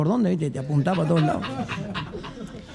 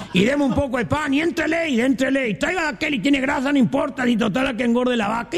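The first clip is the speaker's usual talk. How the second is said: higher, eager orders at the feast; a hungry guest at the party asking for more, higher; greedy guest; 6 semitones higher than usual. Acted. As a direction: rattle off demands with appetite. Guests demanding food at party.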